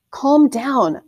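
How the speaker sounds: father calling to the anxious farmer. A narrator's reading. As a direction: call across the yard, firm. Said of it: "Amund called to the farmer".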